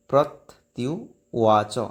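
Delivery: প্রত্যুবাচ drawn out syllable by syllable; slow recitation. Shown as a demonstration. Teacher showing students how to say it, very slow. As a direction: stretch প্রত্যুবাচ slowly with a clear ত্যু. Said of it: "এইভাবে উচ্চারণ হবে".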